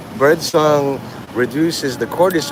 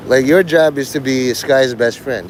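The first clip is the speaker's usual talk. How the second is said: ordinary talk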